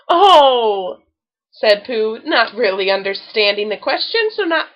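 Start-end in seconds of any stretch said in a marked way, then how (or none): 0.1-1.0 loud startled cry of Oh; surprised oh. A narrator's reading. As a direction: caught off guard, blurt it out. Pooh startled by the question.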